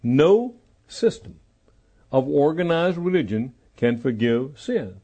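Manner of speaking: slow, deliberate, spaced-out phrases; slow restatement. Real, not acted. Preacher repeats his point to the congregation, slowly and deliberately. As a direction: say it slowly, pausing between phrases. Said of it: "Let me say that slowly".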